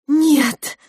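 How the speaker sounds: high, loud cry; involuntary outburst. A narrator's reading. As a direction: let it burst out in alarm. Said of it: "вырвалось у младшей кухарки". (невольный вскрик)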